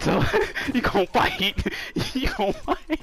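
giggling dare; laughing at the opponent who won't quit; laughing taunt. Real, not acted.

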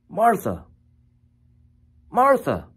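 high falling call of the name, spaced out; acted attention-getting call; getting her attention. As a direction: call the name to pull her focus back. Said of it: "in order to get her attention".

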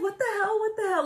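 very high, repeated retold shock; confused disbelief. Woman retelling her stunned answer to fiancé, confused disbelief. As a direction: replay reeling confusion in a high voice. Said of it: "Like, so confused".

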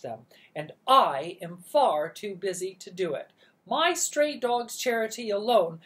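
bossy aunt. Acted.

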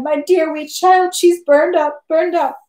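high, drawn-out tearful wail; bursting into sobs. A narrator's reading. Mother mourning her burned child, sobbing again. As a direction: lament in a high voice, acted sobs. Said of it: "And she burst into sobs again".

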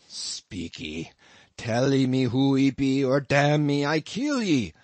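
loud gruff pidgin growl; growled threat. A narrator's reading. Queequeg threatening the stranger again, growling. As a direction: bark the threat at him. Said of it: "again growled the cannibal".

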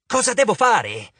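high, demanding cry; impatient shriek. A narrator's reading. Arachne demanding instructions from Annabeth, shrieking impatiently. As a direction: demand it in an exasperated outburst. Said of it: "strillò Aracne".

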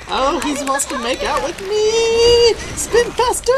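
high, slow, loud panicked shriek; panicked yelling. Real, not acted. Grabbed by monster, must spin.

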